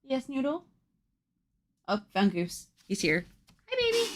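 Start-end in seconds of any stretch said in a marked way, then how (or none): 0.0-0.6 higher, softer pet voice. Real, not acted. To her cat Noodle, a soft high baby-talk reply. 3.7-4.1 very high, soft squeak. Real, not acted. To her cat Goose, a very high soft baby-talk hello.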